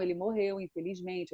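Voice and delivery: triste, em voz baixa e contida; dê a notícia com pesar, caindo o volume; pesar demonstrado. Shown as a demonstration. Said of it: "eu preciso botar a emoção". (tristeza contida, encenada)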